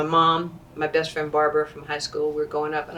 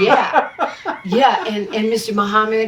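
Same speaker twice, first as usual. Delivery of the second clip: high, louder yeah through laughter; laughing cheeky retort; 4 semitones higher, 5 dB louder, slower than usual. Retold or imitated. Young athlete tells Ali there are two greatest, laughing brashly. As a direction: re-enact a brash answer, cracking up.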